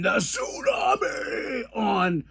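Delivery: high, stretched-out announcer shout; excited move call. Acted. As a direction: belt out the wrestling move, drawn out.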